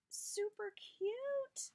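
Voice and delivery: slow, high, cry-like squeal; squealing gush. Real, not acted. Vlogger overwhelmed by a gift charm, high happy squeal. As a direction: let it break into a happy squeal. Whispered then squealed repeat.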